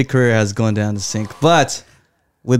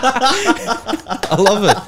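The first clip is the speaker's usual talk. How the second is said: laughing; 11 semitones higher, much slower than usual. Real, not acted. Laughing after joke success.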